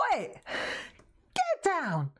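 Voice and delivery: high sharp "Oi, get down" called at the cat; retold shout at cat. Telling the kitten off on the apron, mock high yell.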